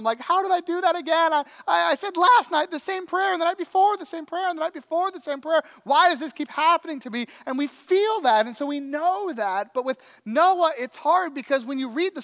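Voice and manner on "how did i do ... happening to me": high, loud plaintive lament; acted exasperated wail; mock crying. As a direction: plead in distress, without real tears. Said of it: "we're crying on the way home".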